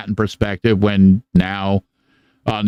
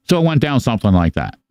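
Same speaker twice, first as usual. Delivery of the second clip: ordinary delivery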